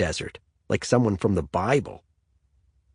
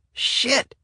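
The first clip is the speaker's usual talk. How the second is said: yell. A narrator's reading. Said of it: "Brian yelled".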